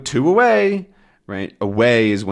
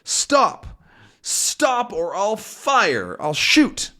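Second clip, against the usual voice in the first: mock yell; 10 semitones higher, much slower than usual. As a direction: call out a high, drawn-out threat. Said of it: "And then Tintin yells".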